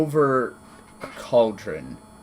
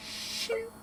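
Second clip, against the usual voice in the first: blade shink; 11 dB quieter than usual. Acted. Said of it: "you hear the slight".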